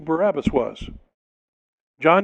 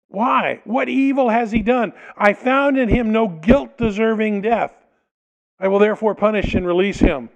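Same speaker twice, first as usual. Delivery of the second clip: pleading Pilate; 6 semitones higher, 4 dB louder than usual. Acted.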